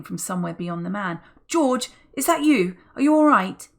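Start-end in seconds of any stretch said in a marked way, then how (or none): 1.5-3.7 high, loud worried call; anxious calling father. A narrator's reading. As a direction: call out fearing for her. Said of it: "anxiously calling".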